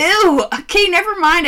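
loud, high, grossed-out squeal; disgusted outburst. Real, not acted. Disgusted by mud talk.

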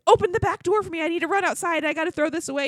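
rushing to get outside; urgent husband. Retold or imitated. Husband carrying the puke bag, urgent high orders. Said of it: "he's like".

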